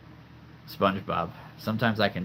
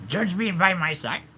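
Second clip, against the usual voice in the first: higher Yoda take; 9 semitones higher, 3 dB louder than usual. Shown as a demonstration. Retrying Yoda for viewers, in a higher Yoda voice. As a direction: squeeze out Yoda one more time. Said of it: "My best attempt right there".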